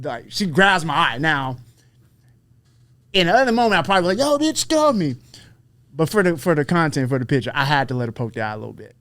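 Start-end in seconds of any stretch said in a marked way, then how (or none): 4.2-5.2 imagined indignant outburst; mock protest. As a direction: act out shoving someone off. Imagined protest at eye poke.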